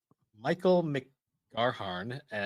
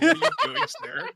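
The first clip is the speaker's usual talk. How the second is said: very high, loud mock squeak; squeaky high voice; 8 dB louder, much faster than usual. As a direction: squeal it in disbelief. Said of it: "everyone was like".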